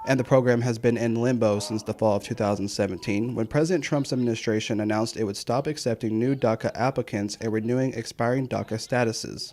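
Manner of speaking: steady anchor-style report; news read. Acted. Voice-over of news story.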